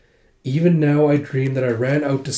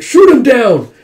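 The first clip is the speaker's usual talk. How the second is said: high, loud urgent yell; shouted order; 10 semitones higher, 11 dB louder, slower than usual. Retold or imitated. Soldier seeing a flying enemy, shouted order. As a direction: bellow a command to fire.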